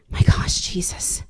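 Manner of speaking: a stunned, reverent whisper; awed whisper. Retold or imitated. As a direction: breathe it out in a hush. Awed aside in retold prayer.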